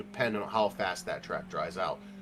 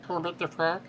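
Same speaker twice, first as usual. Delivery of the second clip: high, pinched frog puppet voice; Kermit the Frog voice; 9 semitones higher than usual. Shown as a demonstration. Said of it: "give it your best Kermit the Frog voice".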